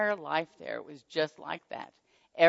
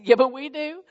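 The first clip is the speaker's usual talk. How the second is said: excited reply; 9 semitones higher, 8 dB louder than usual. Retold or imitated. Answering puzzled donor about groceries.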